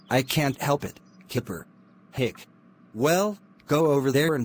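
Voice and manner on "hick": hiccup. Acted. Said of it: "stop doing that".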